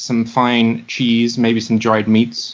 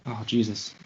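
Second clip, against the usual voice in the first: usual speaking voice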